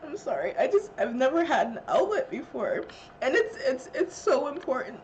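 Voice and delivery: sobbing laugh, breaking high; breaking down mid-sentence; crying. Real, not acted. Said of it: "I'm so sorry".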